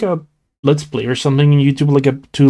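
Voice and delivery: ordinary talk, nothing marked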